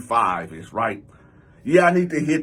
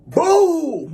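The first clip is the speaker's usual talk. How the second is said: high, loud drawn-out "boom"; imitated vase smash; 11 semitones higher, 6 dB louder than usual. Cousin smashes vase on his back, loud high boom. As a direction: sound out a sudden heavy impact.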